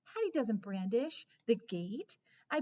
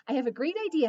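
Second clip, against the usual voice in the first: eager bark; 5 semitones higher, 9 dB louder, much faster than usual. Acted. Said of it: "I bark".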